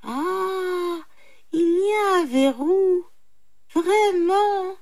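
high, drawn-out jackal wonder; mock amazement. A narrator's reading. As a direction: savour it in feigned surprise, pitched high. Jackal feigns amazement at the bolt. (étonnement feint de chacal, voix aiguë)

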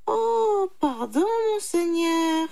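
high, drawn-out apology; apologetic jackal. A narrator's reading. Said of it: "dit le petit chacal". (petit chacal confus, voix aiguë)

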